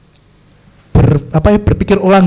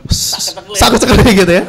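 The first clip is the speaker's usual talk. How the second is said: usual speaking voice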